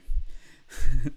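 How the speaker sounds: sudden loud, tearful burst of laughter; a laugh that breaks from holding back tears; real emotion spilling out as a laugh; choked laugh. Real, not acted. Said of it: "Sorry, I'm getting a little emotional".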